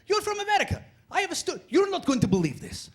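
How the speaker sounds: high-pitched, put-on Croatian accent; excited Croatian storyteller. Croatian finds out he's American, excitedly accented. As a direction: burst out eagerly in a mock Croatian accent. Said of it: "They'd go like".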